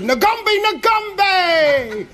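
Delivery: high, loud mock crowd chant; crowd shout. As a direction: whoop it out like a cheering crowd. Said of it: "all Africans shouted".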